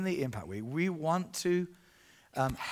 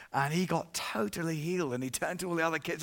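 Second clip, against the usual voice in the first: usual speaking voice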